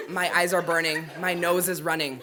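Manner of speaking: high, loud mock-suffering; loud comic misery. Storyteller telling the crowd about juice, mock misery. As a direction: wail about the burning eyes.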